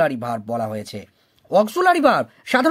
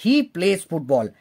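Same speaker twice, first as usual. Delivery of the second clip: slow example; 2 semitones lower, much slower than usual. Shown as a demonstration. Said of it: "যেমন দেখো".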